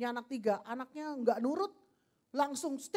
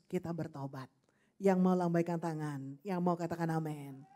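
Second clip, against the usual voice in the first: ordinary talk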